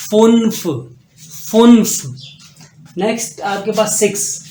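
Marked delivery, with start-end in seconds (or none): 0.1-2.0 फुन्फ said loud and emphatic, rounded u; German fünf. Shown as a demonstration. Teacher drills German five, emphatic. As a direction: punch फुन्फ out clearly. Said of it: "next आपके पास है फुन्फ".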